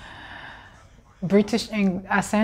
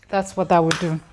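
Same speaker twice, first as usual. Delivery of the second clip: ordinary delivery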